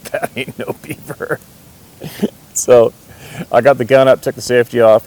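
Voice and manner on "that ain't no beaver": slow, stunned whisper. Retold or imitated. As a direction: breathe it out, stunned and hushed. Sees grizzly, hushed realisation.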